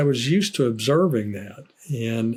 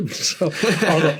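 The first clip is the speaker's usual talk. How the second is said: words breaking into a laugh, pitched up; 5 semitones higher than usual. Real, not acted. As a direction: laugh through the start of the sentence. Laughs mid-sentence about Americans.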